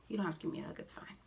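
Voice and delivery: breathy unvoiced whispered aside. Vlogger whispering Tiffany's refusal of the hug.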